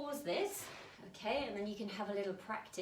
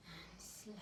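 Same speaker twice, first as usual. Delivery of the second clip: whispered slower; 15 dB quieter than usual. Shown as a demonstration. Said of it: "shake them slower".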